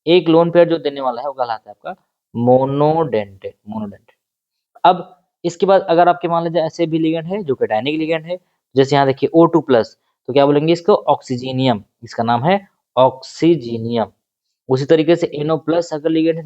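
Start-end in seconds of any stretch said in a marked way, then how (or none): 2.3-3.6 "monodentate" drawn out syllable by syllable; term broken down. Shown as a demonstration. Teacher giving students a new term, "monodentate" slowly broken up. 13.0-14.1 "ऑक्सीजीनियम" slowly split into syllables; term broken down. Shown as a demonstration. Teacher repeating a ligand's name for students, slow and syllable by syllable.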